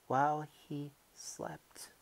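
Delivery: hushed secretive whisper; conspiratorial whisper. Acted. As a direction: whisper it as if he might wake. Creeping secret at midnight.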